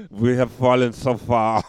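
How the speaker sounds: put-on loud, slow German-accented lament; hammed-up German despair; mock German accent. German brewer lamenting the fall, mock German accent.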